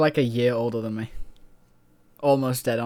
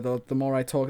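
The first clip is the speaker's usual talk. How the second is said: usual speaking voice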